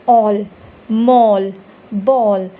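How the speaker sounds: "all, mall, ball" loud, each with a long "aw"; long "aw" sound. Shown as a demonstration. Teacher gives examples of the sound, long "aw". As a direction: punch out "all, mall, ball" singly, rounding the vowel. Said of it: "ये sound क-, create करता है ओ का".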